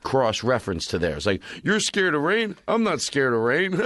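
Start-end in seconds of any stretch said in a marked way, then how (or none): 1.6-3.9 high-pitched scoff with a jeering laugh; mocking others' fears. Imitating people who make fun of someone's fear, mocking. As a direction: tease in a put-on high voice, then cackle. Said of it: "They just make fun of".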